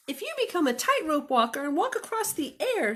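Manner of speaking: high, loud little bunny. Acted. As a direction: play the runaway bunny, bright and eager. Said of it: "said the bunny".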